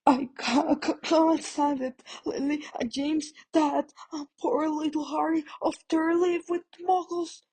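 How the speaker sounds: high, stammering, tearful voice; sobbing. A narrator's reading. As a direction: cry through every word. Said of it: "sobbed Hagrid".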